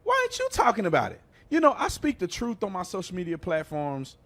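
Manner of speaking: ordinary delivery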